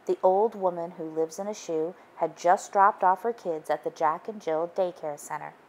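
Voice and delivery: usual speaking voice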